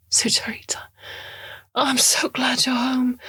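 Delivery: high, hushed voice of a woman in trouble; a hunted woman's relieved whisper; urgent hushed plea. Acted. Eve calling urgently from custody.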